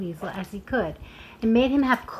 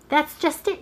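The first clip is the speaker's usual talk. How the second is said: quick reply; 7 semitones higher than usual. Acted. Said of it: "he replied quickly".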